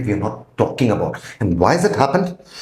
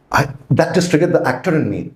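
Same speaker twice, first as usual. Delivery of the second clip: ordinary delivery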